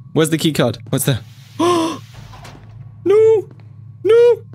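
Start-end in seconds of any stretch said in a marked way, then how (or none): 1.6-2.2 sharp, high gasp; high intake of shock; startled gasp. Real, not acted. Startled by game monster. 3.1-4.4 high, loud cry of alarm; piercing, frightened shouts; panicked scream. Real, not acted. Panics at approaching monster.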